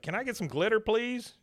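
high, sing-out plea; pleading request. Retold or imitated. Bill voiced asking for glitter.

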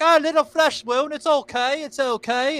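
high, loud brushing-off of a wound; movie cyclist impression. Imitating the cyclist from European Vacation, high and loud. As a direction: wave it off in a high voice like the bike guy. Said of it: "the guy on the bike and he's like".

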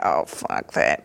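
low, slow, dismissive brush-off. Retold or imitated. Deciding against hospitality work, low slow dismissal. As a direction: drop it low and slow, writing the idea off.

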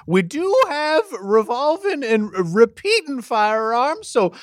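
high folksy drawling judge; character voice. Acted. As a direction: play a chatty frontier judge.

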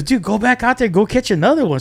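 high-pitched, eager egging-on; excited urging. Retold or imitated. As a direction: imitate a buddy urging someone on. Buddy urges him back out.

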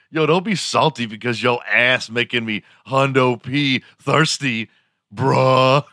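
high, hammed-up slang delivery; mock slang bro. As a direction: overdo the slang, punching the final bruh. Strings slang into a mock boast.

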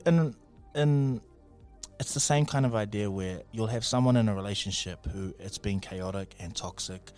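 ordinary delivery

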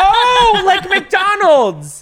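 high, loud and slow "Oh, like McDonald's"; excited realization. Real, not acted. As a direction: burst out with the discovery.

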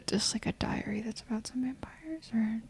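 whispered, very soft self-defence; sheepish whisper. Real, not acted. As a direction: trail away into a whisper. Retreats to a murmur after being questioned.

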